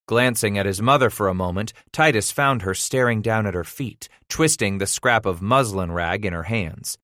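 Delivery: ordinary delivery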